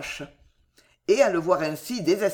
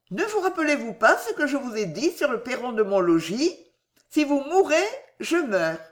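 higher-pitched, earnest feminine voice; tender woman's voice; 6 semitones higher than usual. A narrator's reading. Woman touching his forehead, tenderly recalling her vow. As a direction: remind him earnestly, pitched up. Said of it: "elle le toucha légèrement au front et dit".

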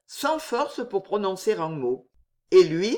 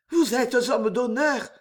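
slow, higher, earnest plea; ardent appeal; 5 semitones higher than usual. A narrator's reading. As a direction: appeal to his honour with a touch of fervour. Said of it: "murmura la jeune fille d'une voix ardente".

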